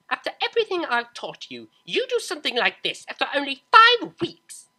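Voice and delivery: high, loud furious rant; enraged mother shouting; furious scolding. Acted. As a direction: tear into her daughter.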